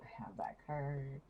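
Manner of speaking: hushed whisper. Real, not acted. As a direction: breathe it out in a whisper. Whispered then repeated.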